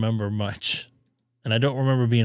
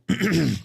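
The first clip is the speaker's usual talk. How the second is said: throat clearing; 5 semitones higher than usual. Real, not acted. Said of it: "Sorry, I'm losing my voice".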